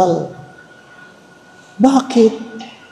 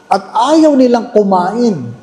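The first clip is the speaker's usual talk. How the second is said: normal talking voice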